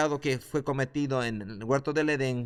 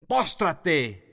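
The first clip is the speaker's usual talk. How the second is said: loud, high command to bow down; commanding shout; 3 semitones higher, 4 dB louder than usual. Acted. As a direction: order him to his knees. Jesús ordena a Satanás. (orden tajante y fuerte a Satanás)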